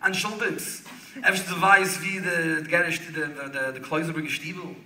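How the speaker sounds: imitating a rebbe's polite Yiddish question. Rebbe asks a stranger for the shtibel, retold in Yiddish. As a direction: ask haltingly in Yiddish, as the rebbe would. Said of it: "impeccable Yiddish".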